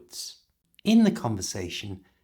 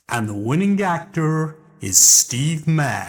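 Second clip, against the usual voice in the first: announcer voice; 4 semitones higher, 8 dB louder than usual. Acted. Award announcement.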